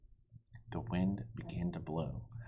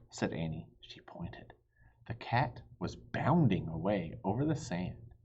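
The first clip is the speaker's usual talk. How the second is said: ordinary delivery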